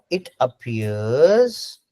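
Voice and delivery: "it appears" drawn out very slowly, rising on appears; slow stress on appears. Shown as a demonstration. Teacher to students, slowly stressing appears. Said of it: "अब यहाँ पे ध्यान से सुनो".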